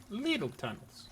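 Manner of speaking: ordinary talk, nothing marked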